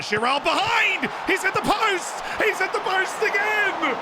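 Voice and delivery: excited commentary shout. Real, not acted. Streamer calling his striker hitting the post, excited shout. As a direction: call the chance like a frantic commentator. Near miss hits the post.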